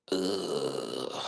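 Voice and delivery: low, quiet "ugh"; long low mock shudder of disgust; disgusted groan. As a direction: groan softly in creeped-out distaste. Voices disgust at creepy idea.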